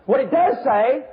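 high-pitched, repetitive pulpit emphasis; forceful preaching. Real, not acted. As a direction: hammer each repeated word.

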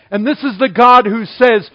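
ordinary talk

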